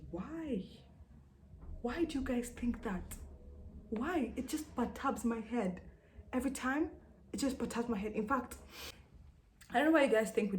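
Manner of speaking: high-pitched, baffled protest; exasperated disbelief. Real, not acted.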